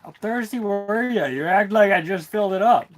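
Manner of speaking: high, loud mock disbelief at a pet; high-pitched, loud ribbing; loud dog teasing. Real, not acted.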